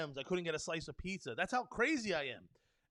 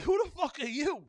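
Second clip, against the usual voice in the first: higher and louder, pure disbelief; louder outburst; 8 semitones higher, 6 dB louder than usual. Real, not acted. Repeating the challenge to hospital gatekeepers, loud and incredulous. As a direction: hit it hard, challenging them.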